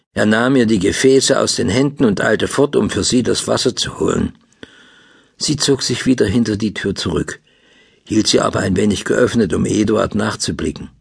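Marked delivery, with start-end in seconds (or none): none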